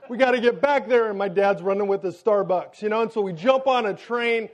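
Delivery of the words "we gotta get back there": higher, louder urgent plea; panicked urgency. Retold or imitated. Panicked new father urging conductors on, higher and louder. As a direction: push hard, we must hurry.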